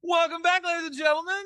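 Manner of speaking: high, ringing show-host greeting; announcer welcome. Acted. Host greeting listeners, in a high announcer voice. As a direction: throw the welcome up high like an emcee. Host opening podcast episode.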